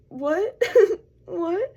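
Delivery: mock-stunned, laughing; baffled Job. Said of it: "And he's like".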